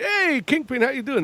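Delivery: high-pitched mock greeting; bystanders' friendly greeting. Everybody greets Kingpin, high-pitched hail. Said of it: "where everybody's like".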